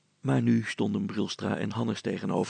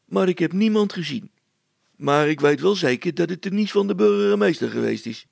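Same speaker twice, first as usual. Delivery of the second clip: put-on higher, louder young man eagerly telling what he heard; a young lad blurting out his news to the schoolmaster, pitched up and loud; excited young man, higher and louder; eager young villager; 7 semitones higher, 8 dB louder than usual. Young villager reports to the teacher.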